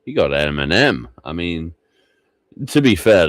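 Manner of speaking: ordinary talk, nothing marked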